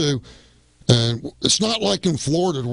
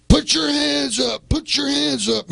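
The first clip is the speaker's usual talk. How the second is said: high, slow barked command; mock police yell; 12 semitones higher, 3 dB louder, slower than usual. Cops yelling at the wrestlers at gunpoint, mock shout. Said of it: "they're yelling at us".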